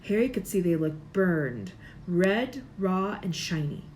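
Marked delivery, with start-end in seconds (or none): none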